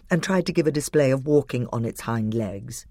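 ordinary delivery

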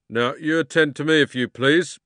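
loud, officious demand; officious policeman. A narrator's reading. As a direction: insist on attention. Policeman takes notes.

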